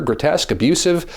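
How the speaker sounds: ordinary talk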